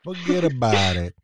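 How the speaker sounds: a drawn-out New York wiseguy "Forget about it"; slow mafia tough guy impression; slow New York accent. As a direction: stretch "Forget about it" like a lazy mobster. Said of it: "repeating mobster catchphrase".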